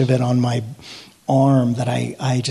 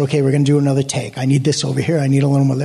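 rushed director rattling off orders; hurried, rapid-fire retelling; talking real fast; much faster than usual. Retold or imitated. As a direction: imitate someone racing through instructions. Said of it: "he started talking real fast".

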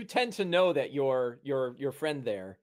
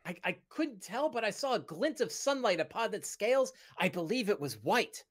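higher, halting, wondering voice; awed companion character; 8 semitones higher than usual. Acted. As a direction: report a thrilling sighting in character. Game master voices companion Bing.

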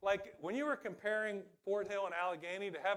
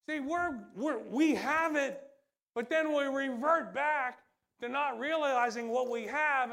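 preaching at full force; 5 semitones higher, 7 dB louder than usual. Acted.

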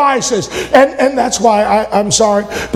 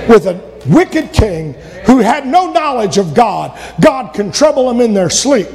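ordinary delivery

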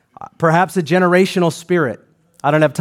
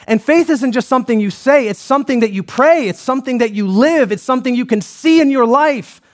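raised, fervent sermon cadence; preaching; 5 semitones higher than usual. Acted. Pastor urging living faith, fervent preaching. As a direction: preach it up to the back row.

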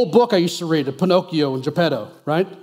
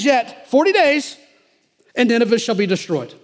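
booming proclamation; 5 semitones higher than usual. Pretend. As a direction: proclaim doom to the whole city. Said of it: "how do you think he sounded?".

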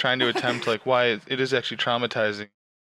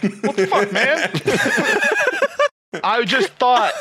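shocked outburst; 10 semitones higher, much slower than usual. Pretend. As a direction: react in outraged shock. Acted shocked guy at spot.

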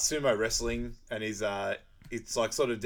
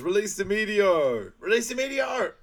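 shouted command; 10 semitones higher, 7 dB louder than usual. Acted. Villain ordering the meteor released, hammed-up shout. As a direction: bellow it like a movie villain. Acted command from film scene.

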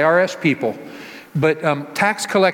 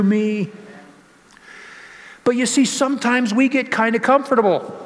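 higher, stirring pulpit voice; fervent preaching; 7 semitones higher than usual. Acted. Pastor preaching to his church about God's power, fervent. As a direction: lift the congregation with wonder at God.

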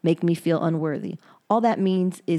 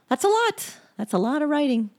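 amazed; 9 semitones higher than usual. Real, not acted. Marvelling at forty-five entries.

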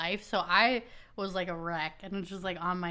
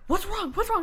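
lightly voiced alarmed partner, higher pitch; alarmed boyfriend; 12 semitones higher, 4 dB louder than usual. Partner asks her what happened, mock alarm. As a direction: imitate a startled partner. Zach reacting to her panic.

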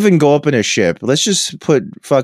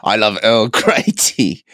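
Picard parody; 2 semitones lower, slower than usual. Pretend.

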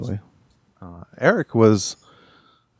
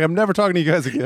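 high, fast mock huff; mock belligerent; 7 semitones higher, faster than usual. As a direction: play an offended guest storming off. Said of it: "he's going to be belligerently".

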